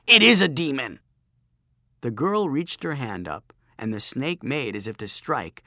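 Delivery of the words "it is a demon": loud, high, angry retort; indignant insistence. Acted. Bully contradicting the doubting girl, loud angry insistence. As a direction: insist hotly at full voice.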